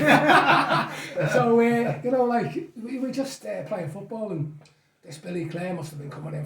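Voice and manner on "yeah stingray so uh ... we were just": laughing, higher and louder; real laughter spilling into talk. Real, not acted. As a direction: crack up at the stingray joke. Laughing at stingray joke.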